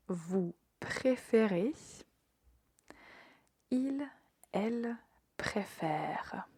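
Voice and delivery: slow, spaced-out conjugation drill; repeat after me. Shown as a demonstration. French teacher has students repeat present tense, slow and deliberate. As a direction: pronounce each form slowly, pausing for learners to repeat. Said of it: "Répétez après moi".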